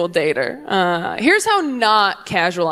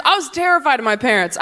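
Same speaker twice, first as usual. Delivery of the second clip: normal talking voice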